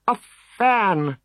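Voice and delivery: high, delighted bard voice; thrilled to find an audience; delighted cry. Acted. Cacophonix greeting the soldiers, high and delighted. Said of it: "cried the delighted Cacophonix".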